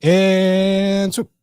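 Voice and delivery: high, loud, drawn-out build-up to a cue; playful announcing. Pretend. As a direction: stretch it out like a launch countdown's end. Playful cue for switching screens.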